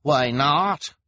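high, loud challenge; demanding. Acted. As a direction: demand an answer in front of a crowd. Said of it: "demanded the woman with dyed hair".

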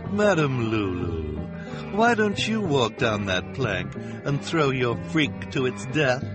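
high, slow, sugary malice; syrupy, drawn-out insincere coaxing; false sweet voice. Acted. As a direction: suggest it with fake sweetness. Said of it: "in a false, sweet voice".

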